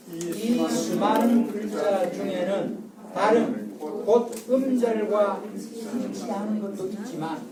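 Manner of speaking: slow, measured textbook read-aloud. Shown as a demonstration. Teacher leads class reading the textbook aloud, slow and measured. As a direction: read the passage slowly for the class to follow. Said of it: "다 같이 시작".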